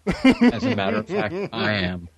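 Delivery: high, laughing mock confession; laughing reply. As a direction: laugh through a pretend admission. Joking reply to drunk joke.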